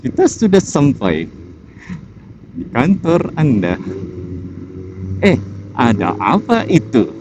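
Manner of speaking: lightly imitated AI navigation voice; sing-song robotic announcer read; chipper text to speech voice. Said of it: "gue bisa niruin suara A I".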